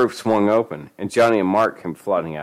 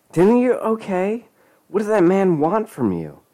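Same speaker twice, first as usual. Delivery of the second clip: high-pitched, worried questioning; frantic woman; 13 semitones higher than usual. A narrator's reading. Frantic Lisa questions Denny.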